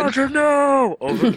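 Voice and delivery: high, hammed-up wail of grief; mock anguished cry. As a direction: cry out over a fallen comrade. Mock grief over radio.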